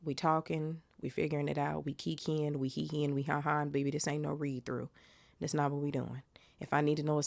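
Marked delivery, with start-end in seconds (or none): none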